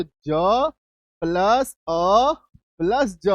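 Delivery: ज, अ, ज drawn out slowly with plus between; slow, emphatic phonics breakdown of ज अ ज; sounded out. Shown as a demonstration. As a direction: spell out each sound separately, ज plus अ plus ज. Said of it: "जज जब हम लोग लिखते हैं".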